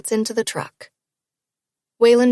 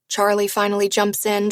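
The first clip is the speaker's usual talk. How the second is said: usual speaking voice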